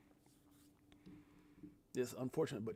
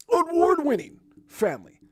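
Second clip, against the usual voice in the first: high booming hype voice, award pushed up; new announcer voice; 10 semitones higher, 6 dB louder than usual. Shown as a demonstration. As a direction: belt it out like a ring announcer. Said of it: "My throat gets all scratched up".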